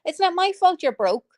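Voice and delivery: ordinary talk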